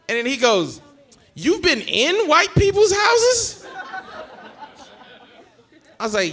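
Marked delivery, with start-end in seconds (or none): 1.3-3.6 high, slow, drawn-out disbelief; hammed-up shocked granddad, stretching each word; incredulous. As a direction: imitate a stunned, high-pitched question.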